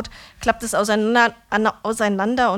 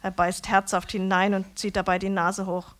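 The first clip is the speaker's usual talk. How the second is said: usual speaking voice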